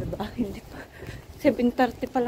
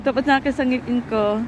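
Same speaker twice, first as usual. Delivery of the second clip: shivering from the cold; cold and shaking while talking. Real, not acted. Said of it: "Nanginginig yung katawan ko ng, sa lamig".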